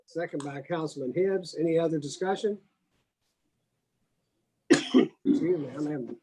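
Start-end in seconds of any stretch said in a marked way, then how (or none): 4.7-6.2 coughing after water went down the wrong pipe; choked on water, clearing the throat. Real, not acted. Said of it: "water went down the wrong pipe".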